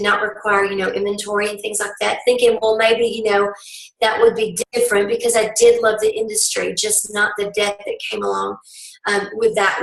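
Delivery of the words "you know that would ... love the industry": ordinary talk, nothing marked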